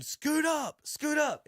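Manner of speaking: retold yell. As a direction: imitate yelling instructions from the sideline. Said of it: "So I'm yelling".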